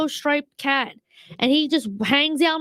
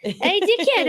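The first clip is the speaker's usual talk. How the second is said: Australian accent; 8 semitones higher, slower than usual. Shown as a demonstration. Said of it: "I wanna give him an Australian accent".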